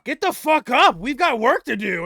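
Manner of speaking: high, loud barked order; angry shout. Acted. Brood yelling at Zara lying in the snow, loud angry shout. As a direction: yell at her to get up and move. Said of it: "I say".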